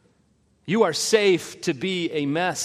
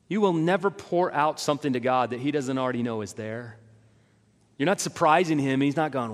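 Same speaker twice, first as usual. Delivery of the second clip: normal talking voice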